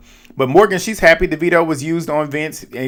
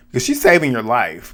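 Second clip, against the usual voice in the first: ordinary talk, nothing marked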